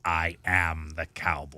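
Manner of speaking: put-on low, slow evil emperor, no accent; Palpatine. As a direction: declare your absolute rule, drawn out and deep. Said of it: "That is very Palpatine-esque".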